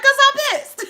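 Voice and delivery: high, loud comic outburst; mock pissed-off yell. Streamer retelling her answer about screaming, high mock-angry yell. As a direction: yell it as a joke, voice leaping way up. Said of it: "somebody was like, "Why are you screaming?".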